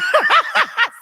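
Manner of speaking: a high burst of laughter. Real, not acted. Laughs at own joke.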